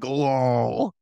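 lightly voiced demon, low and smug; a hint of a gloating demon. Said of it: "just hear a demon voice go like".

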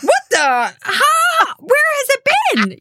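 high shouted mock alarm; imitating a startled shriek; mock shock. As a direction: shriek it in pretend horror. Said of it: "when you're like".

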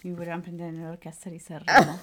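ordinary delivery

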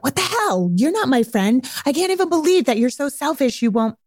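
hurt, incredulous blow-up, acted; mock outrage. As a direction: act the offended friend. Acted outraged friend's reaction.

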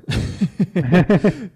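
high, loud chuckle; amused laugh. Real, not acted. Podcaster laughing after admitting possible hypocrisy, amused laugh.

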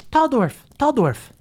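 put-on high, chirpy children's chorus voice; excited little kids. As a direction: squeal like tiny excited kids. Kids crowd around.

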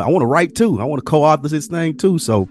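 ordinary talk, nothing marked

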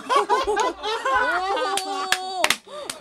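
long high-pitched burst of laughter; loud laugh. Real, not acted. Laughs at own retold line. (甲高く長い大笑い)

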